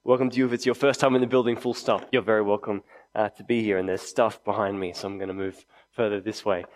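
ordinary talk

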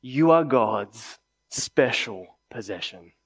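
slow, weighty proclamation; said powerfully. Retold or imitated. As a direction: land each word slowly and firmly. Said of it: "he says powerfully".

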